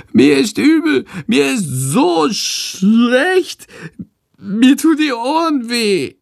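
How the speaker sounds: very high, slow, drawn-out creature whine; wailing. A narrator's reading. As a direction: moan miserably like a sick little animal. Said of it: "Jetzt jammert Shandor". (jammernd, sehr hoch und gedehnt)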